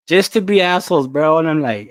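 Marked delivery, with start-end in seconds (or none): none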